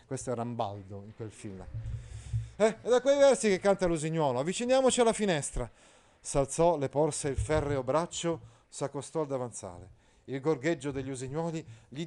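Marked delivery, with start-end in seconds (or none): 2.6-5.7 high, loud pompous knight; Agilulfo pompous. Acted. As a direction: declaim like a stiff courtly knight. Agilulfo proposes moving to window. (Agilulfo, cavaliere pomposo, acuto e forte)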